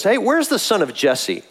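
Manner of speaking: a hint of contempt, not using his name; dismissive Saul. A pastor voicing Saul brushing David off, mildly dismissive. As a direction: sneer at the name you won't say. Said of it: "the way Saul says this".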